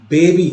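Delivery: बेबी as in English, not बाबी; English-style Baby. Shown as a demonstration. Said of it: "इसको बाबी नहीं पढ़ेंगे, इसको बेबी पढ़ेंगे".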